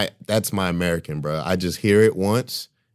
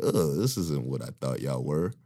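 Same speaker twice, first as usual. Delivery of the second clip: disgusted; 7 semitones lower, 9 dB quieter than usual. Retold or imitated. Retold disgusted reaction.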